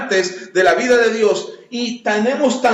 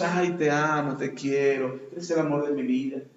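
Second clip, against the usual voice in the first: mock tender; 6 semitones lower, 6 dB quieter than usual. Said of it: "los hombres no decimos".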